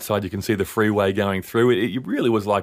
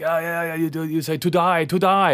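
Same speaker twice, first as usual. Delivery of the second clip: higher mock Japanese guy doing an Aussie accent; imitating Japanese man's Aussie accent; 9 semitones higher than usual. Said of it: "his play on our accent".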